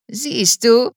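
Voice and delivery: high, loud and spiteful; gloating. A narrator's reading. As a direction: sneer it with triumph. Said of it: "sagte Höhnfeld hämisch".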